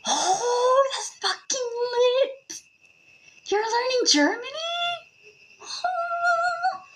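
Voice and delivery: very high, squealed reaction; gushing excitement. Real, not acted. Gushing reaction to someone learning German.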